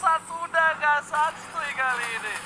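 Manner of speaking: fast, high, loud teamfight call; hype casting. Real, not acted. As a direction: scream the kills out live.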